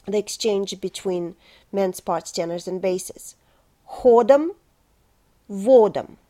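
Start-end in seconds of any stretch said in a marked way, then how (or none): 3.9-6.2 Ходом, водом split into round dark O syllables; слог за слогом. Shown as a demonstration. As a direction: break Ходом and водом apart, clearly. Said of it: "I will say each word syllable by syllable".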